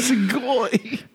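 shouted anime exclamation; overacted anime dub. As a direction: bark it like a cartoon hero.

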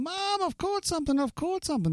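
put-on high, excited child calling to his mum; a toddler proudly shouting he's caught something; excited little boy. Brother as a toddler calling to mum, high and excited. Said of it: "and he's going".

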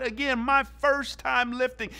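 ordinary talk, nothing marked

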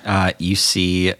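usual speaking voice

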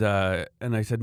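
ordinary delivery

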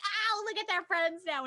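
high, loud, doting coo. Retold or imitated. Watching the rat being petted, cooing. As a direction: coo like at a puppy. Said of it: "and I was like".

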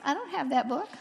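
cute little voice, lightly imitated; a hint of cutesy imitation; Jerry. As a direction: lightly put on a sweet little pleading voice. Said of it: "in his cutest little Jerry voice".

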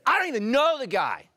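high, loud, panicked denial; acted scared, defensive outburst; frightened denial. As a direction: deny it hotly out of fear. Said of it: "He was afraid".

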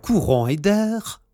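ordinary delivery, nothing marked

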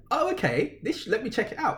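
high, curious oh okay; lightly amused interest rising high; mock intrigued surprise. Him hearing about Caleb Plant, surprised and high. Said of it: "So I was like".

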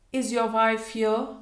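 a touch brusque; brief demand. A narrator's reading. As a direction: demand it in few words. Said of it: "demanded the colonel briefly".

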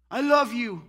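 hammed-up boyfriend begging his girlfriend not to leave; pleading lover. Lover chasing woman who leaves.

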